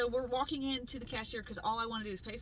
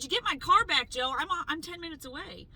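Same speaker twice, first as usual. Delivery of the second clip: loud, higher angry demand at Joe; retold angry demand; 6 semitones higher, 8 dB louder than usual. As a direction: order him to bring the car back, fuming. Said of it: "Um, I was so mad".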